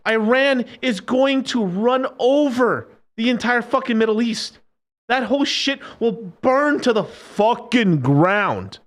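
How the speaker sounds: slow, high, hammered-out rant; emphatic warning. Real, not acted. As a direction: spell out the doom word by word. Emphatic doom rant about Middle East.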